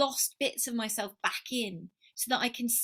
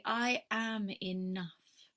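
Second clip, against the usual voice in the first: slow whispered self-affirmation; 2 semitones lower, 3 dB quieter, much slower than usual. Pretend. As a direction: breathe it to yourself, gently and slowly.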